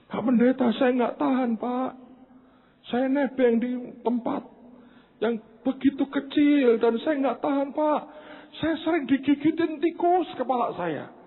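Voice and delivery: high-pitched, pleading mock-tearful voice; imitated crying. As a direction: pour out her misery like a weeping caller. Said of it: "Dia nangis dan saya kaget". (menirukan ibu menangis mengadu)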